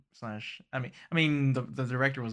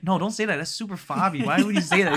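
higher, louder protest; objecting; 6 semitones higher, 6 dB louder than usual. Retold or imitated. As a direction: push back, half laughing. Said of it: "Molly was like".

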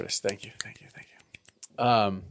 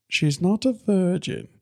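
Ace Ventura quote; 10 semitones higher than usual. Retold or imitated. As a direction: drag it out like the film line. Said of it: "that quote from Ace Ventura".

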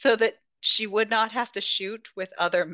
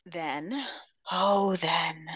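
hushed teasing whisper; dramatic whisper; 2 semitones lower, much slower than usual. Real, not acted. Podcaster teasing a twist to cohost, whispered. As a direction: lean in and whisper for suspense.

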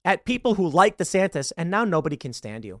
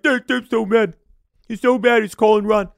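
mocking whiny critics; 6 semitones higher, 6 dB louder than usual. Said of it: "get all salty".

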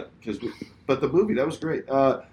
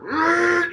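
high vocal engine screech; imitated car screech. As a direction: mimic a car suddenly tearing past. Imitates car swerving out of nowhere.

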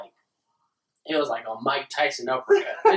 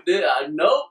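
ordinary delivery

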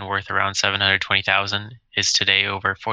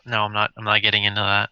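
usual speaking voice

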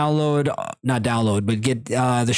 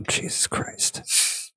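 under the breath, slowly; whispered; 7 dB quieter, much slower than usual. Real, not acted. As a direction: mutter it to yourself in a whisper.

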